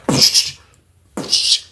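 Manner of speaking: unvoiced psh of a door being rammed; imitated slamming door; door bang noise. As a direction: hiss out a bang sound. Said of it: "it sounded like".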